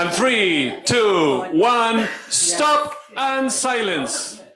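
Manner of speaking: drawn-out facilitator's countdown to silence; loud countdown. Real, not acted. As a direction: call the count over the room. Counting down to halt the activity.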